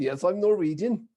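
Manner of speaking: put-on Norwegian accent. Traveler answering a guy at the airport, Norwegian accent. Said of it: "with a Norwegian accent too".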